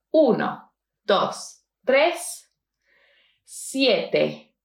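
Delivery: "uno, dos, tres", "siete, ocho, nueve", "cuatro, cinco, cero, dos" in groups with pauses between. Shown as a demonstration. Teacher dictating a phone number, pausing after "tres" and "nueve". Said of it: "I'm pausing between the one, two, three".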